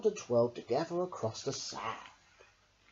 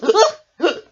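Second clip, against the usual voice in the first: hiccup laugh; 17 dB louder than usual. A narrator's reading. Hiccup returns.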